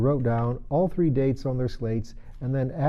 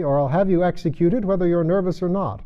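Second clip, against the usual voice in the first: angry; 7 semitones higher, 6 dB louder than usual. Acted. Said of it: "the king repeated angrily".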